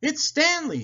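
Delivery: high, loud excited exclamation; Thomas, gasping. A narrator's reading. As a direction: exclaim it high, in sudden relief. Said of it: "he gasped".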